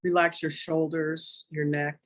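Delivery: calm, unhurried meditation guide voice; guided meditation. Acted. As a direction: ease the listeners into stillness, gently.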